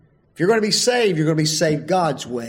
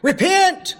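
high, loud call of Repent!; yelling; 14 semitones higher, 5 dB louder than usual. Shown as a demonstration. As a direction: shout Repent! across to someone. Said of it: "and I yell at a person".

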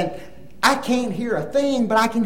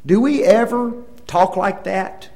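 ordinary talk, nothing marked

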